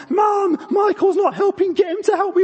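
put-on very high, loud, whiny tattling kid; ten-year-old Stephen. Ten-year-old running up to Mum about his brother, shrieked whine. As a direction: squeal it like a child telling tales. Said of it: "Little ten-year-old Stephen running up to Mum".

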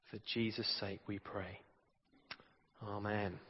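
soft, low, reverent prayer. Acted. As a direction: pray quietly and humbly. Leads the congregation in prayer.

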